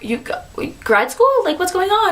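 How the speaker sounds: mimicking coworkers who can't follow; confused coworkers. Coworkers confused by her outburst.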